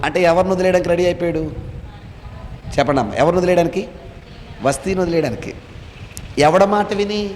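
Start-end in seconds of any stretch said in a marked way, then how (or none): none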